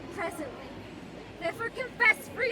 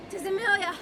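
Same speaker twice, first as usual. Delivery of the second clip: ordinary delivery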